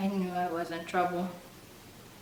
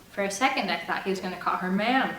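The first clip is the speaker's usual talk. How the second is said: ordinary delivery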